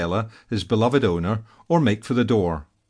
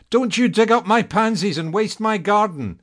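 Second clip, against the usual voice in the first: high, raised call from across the garden; 10 semitones higher than usual. A narrator's reading. Owner calling through the window to scold the digging cat. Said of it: "Annabella called from the other side".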